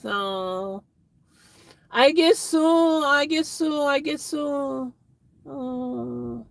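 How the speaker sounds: drawn-out, high mock whine; playful whine. Streamer answering Karen about the P O box, mock whine. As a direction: whine slowly like a reluctant kid.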